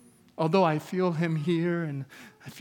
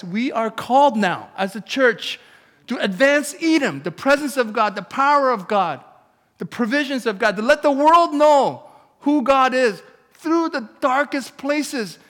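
much higher, much louder rallying cry; preaching at full force; 9 semitones higher, 11 dB louder than usual. Acted. Pastor rallying the church to take Vancouver, preaching at full force. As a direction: shout the call to advance like a battle charge.

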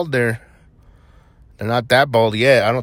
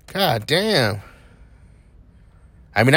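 normal talking voice

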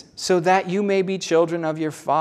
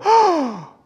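sharp, high gasp of amazement; astonished gasp; 6 dB louder than usual. Acted. Angel spotting the first humans, astonished gasp. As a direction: play a startled angel gasping.

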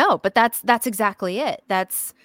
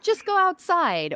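mock advice; 11 semitones higher, slower than usual. Mocking dismissive advice.

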